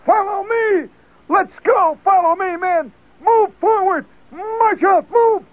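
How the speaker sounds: high, loud combat yells; first sergeant leading the charge on the latrine; hollering sergeant. Retold or imitated. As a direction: charge like a soldier in battle. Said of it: "he's hollering stuff like".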